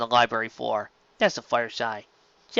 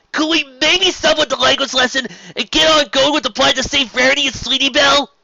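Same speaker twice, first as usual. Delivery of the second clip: put-on much higher, louder impatient young dragon; Spike's voice; 13 semitones higher, 8 dB louder than usual. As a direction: cut in like an impatient kid.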